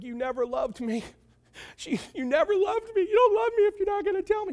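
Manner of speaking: very high, hammed-up tearful wail; mock weeping. Wife guilt-tripping Samson, mock weeping. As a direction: whine it way up high, fake crying. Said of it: "she starts weeping".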